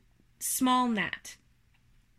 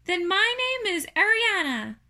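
high, loud little voice; small creature pitched well up; second little spider; 10 semitones higher, 5 dB louder than usual. Acted. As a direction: announce it as a tiny spider.

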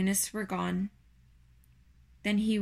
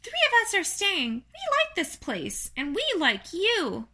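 high, small spider voice; high-pitched friendly little voice; 9 semitones higher than usual. Acted. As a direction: pipe up sweetly as a tiny creature.

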